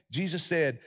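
ordinary talk

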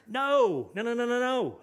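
loud, high, forceful protest; emphatic refusal. Acted. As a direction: burst out in firm rejection. Acting out emphatic answer to question.

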